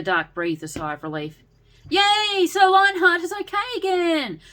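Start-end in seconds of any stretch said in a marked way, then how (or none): 1.9-4.4 very high, loud, bouncing joy; hyperactive cheer. A narrator's reading. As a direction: shout for joy like an excited kid. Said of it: "jumped in his usual hyperactive way".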